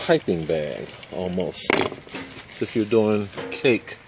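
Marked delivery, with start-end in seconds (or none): none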